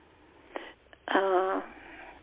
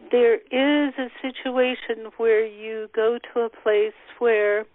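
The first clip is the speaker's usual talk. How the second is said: ordinary talk, nothing marked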